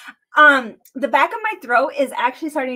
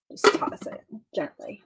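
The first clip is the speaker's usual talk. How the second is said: soft whisper to herself; 4 semitones lower, 9 dB quieter than usual. Real, not acted. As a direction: whisper it while working carefully. Gently tossing the drink.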